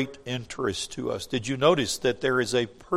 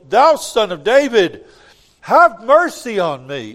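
crying out louder; 8 semitones higher, 11 dB louder than usual. Acted. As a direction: cry out insistently for mercy over the crowd. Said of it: "he cried the more a great deal".

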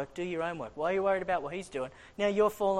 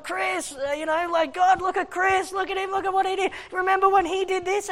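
high, loud mocking accuser; shrill up and point the finger; accusing devil; 11 semitones higher, 6 dB louder than usual. The devil accusing Chris before God, high mocking voice. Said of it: "the devil's going before the Lord".

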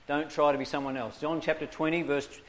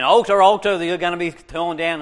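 booming prophetic shout at the altar; preacher's loud cry; 10 dB louder than usual. Retold or imitated. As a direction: thunder it out like a fiery preacher. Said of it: "the preacher there saying".

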